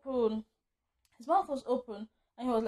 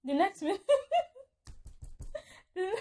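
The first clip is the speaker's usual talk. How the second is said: higher voice collapsing into real giggles; laughing again; 9 semitones higher than usual. Real, not acted. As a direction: try to tell it, then crack up. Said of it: "I'm laughing again".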